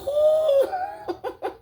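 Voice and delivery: high squealing laugh. Real, not acted. Laughing at her own fright, a high squeal.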